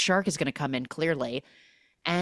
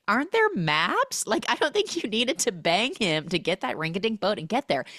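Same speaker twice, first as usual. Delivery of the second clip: incredulous; 5 semitones higher than usual. Acted. Own incredulous reaction to the story.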